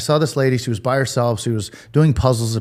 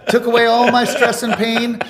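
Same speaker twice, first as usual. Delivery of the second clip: laughing; 11 semitones higher than usual. Real, not acted. Still laughing at son's reply.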